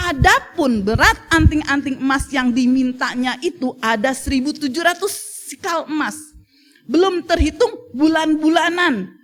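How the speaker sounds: loud, rising sermon voice with a laugh in it; preacher marvelling at the gold; fervent preaching. Acted. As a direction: build the list of riches with excitement.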